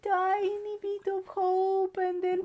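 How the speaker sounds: high whiny complaint. Pretend. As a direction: whine it, hammed-up. Complaining about the game.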